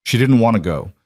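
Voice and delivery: ordinary delivery, nothing marked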